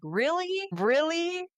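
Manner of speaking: high, rising questions of disbelief; incredulous. Retold or imitated. Guest recalling her first watch of the amnesia twist, high incredulous questioning.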